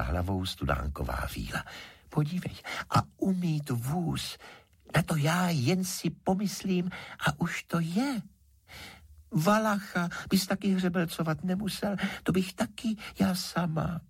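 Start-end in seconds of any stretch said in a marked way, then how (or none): 2.1-14.0 high, coaxing fairy voice; thin high sprite making her offer; pleading water fairy. A narrator's reading. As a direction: coax and wheedle in a little high voice.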